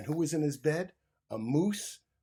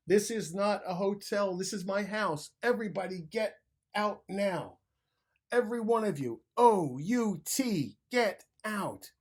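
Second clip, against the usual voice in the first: lost temper; 6 semitones higher, 4 dB louder than usual. A narrator's reading. Bruce loses his temper and shouts everyone out of his house. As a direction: throw them all out. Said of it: "Bruce lost his temper".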